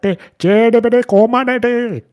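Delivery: high, loud dubbed-hero yell; mock shouting. Friend mimicking Urdu-dubbed Neo, high mock shout. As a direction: bellow it like a badly dubbed action hero. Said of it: "he's shouting at them".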